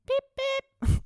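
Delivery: high, loud mock car-horn beeps; car horn imitation. Host mimicking a car honking at her, high playful beeps. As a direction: honk like a passing car, squeaky and high. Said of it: "doing more sounds than I've ever done".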